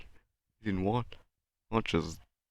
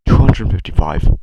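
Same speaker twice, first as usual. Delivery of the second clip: much louder than usual, brisk; loud announcement; 13 dB louder than usual. Real, not acted. Vlogger revealing how many assets he made, loudly. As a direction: call the number out loudly.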